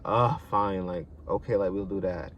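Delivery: low, soft, resigned agreement; retold half-hearted consent; reluctant giving in. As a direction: give in wearily, voice dropped. Giving in reluctantly to his advances.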